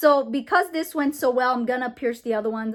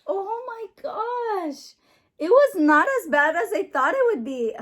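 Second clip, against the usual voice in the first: relieved and shaky; 7 semitones higher than usual. Real, not acted. Girl relieved after piercing, high tearful gasp. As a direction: let the relief shake out high.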